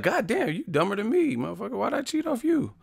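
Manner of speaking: high mock cry of disbelief at a dumb classmate; exasperated cheater. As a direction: squeal it like you just got burned.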